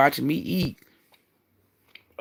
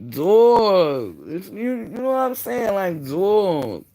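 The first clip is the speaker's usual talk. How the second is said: exasperated; 7 semitones higher, 4 dB louder, slower than usual. Real, not acted.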